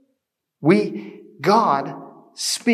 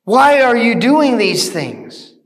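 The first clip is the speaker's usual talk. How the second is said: loud, raised appeal to a crowd; crying out; 6 semitones higher, 5 dB louder than usual. Acted. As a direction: call out urgently, projecting like a preacher. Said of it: "And they cry out".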